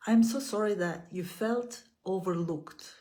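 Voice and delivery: measured, gentle affirmations with long pauses between. Acted. Leads repeat-after-me affirmations.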